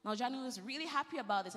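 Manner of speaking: ordinary talk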